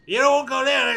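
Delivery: high, loud warning; acted warning. Prisoner warning captors away from the camp, high and loud. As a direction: cry out to warn them off.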